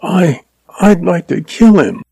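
put-on high-pitched, mildly angry girl; girlish flash of anger; angry young woman. A narrator's reading. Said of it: "Her cheeks flamed in anger".

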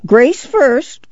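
put-on high, insistent old-woman demand; clamor for it shrilly; clamoring grandma. A narrator's reading. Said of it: "Grandma clamored".

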